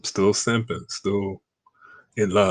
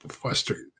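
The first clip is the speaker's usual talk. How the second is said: whispered aside; 4 dB quieter than usual. Real, not acted. Aside about his feelings.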